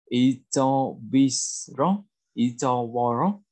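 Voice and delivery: very slow, syllable by syllable; drawn-out language-teacher demonstration; slow model reading. Shown as a demonstration. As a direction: stretch out each syllable for learners. Teacher models new word slowly first.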